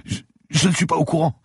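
a beaten lawyer gasping in fear; frightened bleeding man pleading with the cop; terrified captive. Acted. Lawyer denies.

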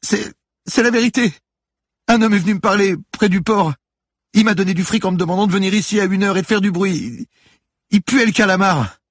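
very high, loud, stammering confession; crying teenager's high-pitched protest; tearful pleading. A narrator's reading. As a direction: blurt it out like a terrified boy in tears. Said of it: "se mit à pleurer".

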